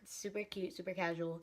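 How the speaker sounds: usual speaking voice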